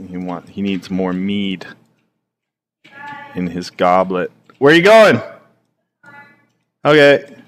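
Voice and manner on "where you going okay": much higher and louder shout; giant calling out. Acted. Giant shouting at the fleeing man, loud high call. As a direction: yell after him as a giant.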